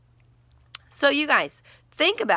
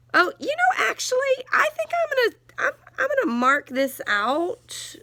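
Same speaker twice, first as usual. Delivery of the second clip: sudden idea; 14 semitones higher than usual. Real, not acted. Teacher suddenly decides to edit.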